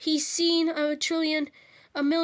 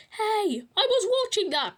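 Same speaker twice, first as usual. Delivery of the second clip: protesting Peter; 7 semitones higher, 4 dB louder than usual. A narrator's reading. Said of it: "protested Peter".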